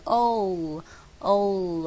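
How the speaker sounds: "whole" with a long drawn o, slow. Shown as a demonstration. Teacher drills the long o in "whole". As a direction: stretch the o of "whole". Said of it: "是长母音O".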